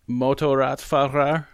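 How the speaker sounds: Motorradfahrer slowly sounded out as a German word by an English speaker; carefully butcher Motorradfahrer, syllable by syllable; attempted German. Shown as a demonstration. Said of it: "Let's see if I can butcher this properly".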